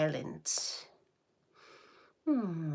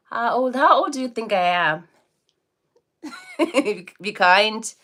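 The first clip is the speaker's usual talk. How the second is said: laughing tease; 4 semitones higher, 8 dB louder than usual. Real, not acted. Teasing viewers about her age.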